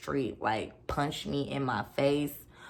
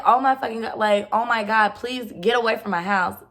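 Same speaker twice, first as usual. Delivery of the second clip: higher, louder frantic pleading; retold panicked plea; 5 semitones higher, 6 dB louder than usual. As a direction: beg him to leave in a panic. Retold panicked pleading during break-in.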